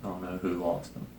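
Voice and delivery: ordinary talk, nothing marked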